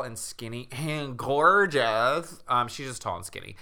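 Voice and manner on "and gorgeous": stretched-out, slow swooning praise; hammed-up gushing. Podcaster overselling the heroine's looks, hammed-up gushing. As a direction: draw it out, gushing over her looks. Hammed-up joke, then corrects to tall and skinny.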